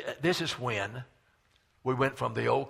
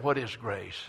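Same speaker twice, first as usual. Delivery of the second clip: normal talking voice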